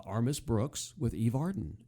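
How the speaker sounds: ordinary delivery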